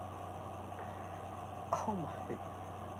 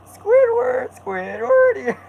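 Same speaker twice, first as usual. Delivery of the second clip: very high, loud calling of Squidward; squeaky cartoon call; 6 dB louder, much slower than usual.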